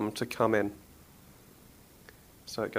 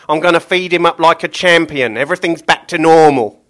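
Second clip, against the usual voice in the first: loud higher proud butcher; gleeful Billy; 5 semitones higher, 11 dB louder than usual. Acted. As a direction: promise a feast. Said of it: "grinned Billy".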